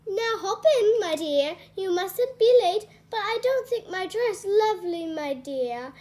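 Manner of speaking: lightly voiced, cheerful fairy godmother; fussing fairy godmother. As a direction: bustle her along like a doting godmother. Fairy godmother hurrying Cinderella.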